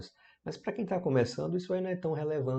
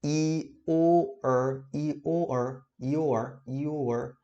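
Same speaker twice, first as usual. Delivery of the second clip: "your" repeated, stretched, all three sounds; teacher repeating "your" for practice; slow drill; 2 semitones higher, much slower than usual. Shown as a demonstration. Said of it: "quando a gente fala assim".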